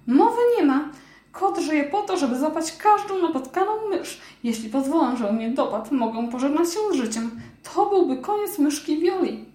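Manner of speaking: a scared mouse flatly refusing; indignant mouse. Acted.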